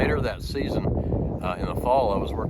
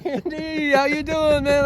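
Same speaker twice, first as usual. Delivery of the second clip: cheerful greeting; 5 dB louder, slower than usual. Retold or imitated. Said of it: "I said".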